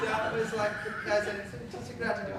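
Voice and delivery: real laughter running into the words; laughing. Real, not acted. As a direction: laugh through the lines. Laughing at shop misunderstanding.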